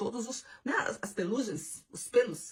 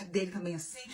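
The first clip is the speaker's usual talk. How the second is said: ordinary talk, nothing marked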